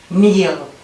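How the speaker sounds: ordinary talk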